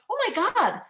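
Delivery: high, loud, thrilled burst; excited realization. Retold or imitated. Retelling her birthday discovery to the group, excited and triumphant. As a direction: excitedly announce it, breaking into laughter.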